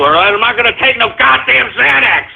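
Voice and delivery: ordinary delivery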